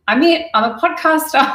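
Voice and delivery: hammed-up proud announcement; playfully boasting about a new podcast; mock proud. Mock proud self quote.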